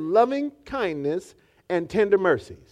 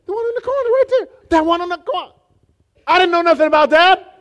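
church member voice; 13 semitones higher, 7 dB louder, faster than usual. Retold or imitated. Voicing a confused church member.

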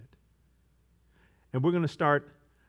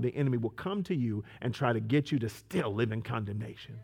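ordinary talk

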